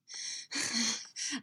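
voiceless panted breath; panting sigh. A narrator's reading. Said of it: "she panted".

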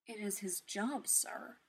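soft, hushed AI voice; a hint of a quiet butler. A narrator's reading. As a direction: say it quietly, gently. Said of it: "his voice quiet".

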